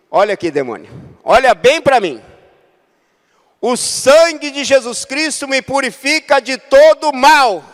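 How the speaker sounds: loud, high commanding shout; commanding rebuke. Retold or imitated. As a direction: cast the demon out with authority. Casting out the demon. (repreensão em voz alta e aguda)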